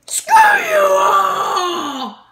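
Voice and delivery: slow, loud sobbing shout; crying shout. Acted. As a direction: drag it out in a wail. [name]'s put-on voice.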